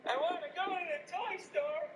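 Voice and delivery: high-pitched whining child impression; little kid. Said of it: "be like some little kid".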